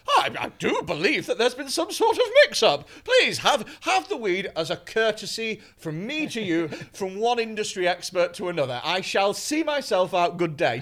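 loud, hammed-up posh formal voice; mock posh gent. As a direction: play the gracious gentleman, laughing. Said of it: "polite my way out".

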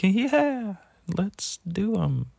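high, soft, drawn-out fake agreement; nervous agreement. To friends talking about drugs, nervous fake agreement. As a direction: imitate going along uneasily, slow and high. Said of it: "fucking make me so nervous".

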